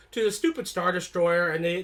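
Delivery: ordinary talk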